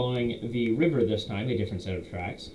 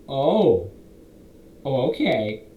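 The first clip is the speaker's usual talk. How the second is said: startled; 3 semitones higher, 6 dB louder, slower than usual. Acted. Druid startled as goblin vanishes.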